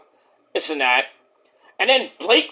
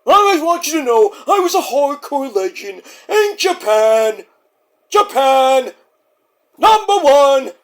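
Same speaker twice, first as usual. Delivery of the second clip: higher, louder bragging character; boastful put-on voice; 8 semitones higher, 4 dB louder than usual. Skit wrestler brags he was a legend in Japan, higher and louder. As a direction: brag about Japan in character.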